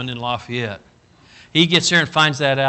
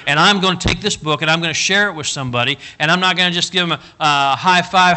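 fiery preaching; 2 semitones higher, 3 dB louder than usual. Acted. Sermon builds to full force.

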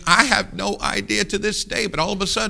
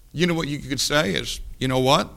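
ordinary delivery

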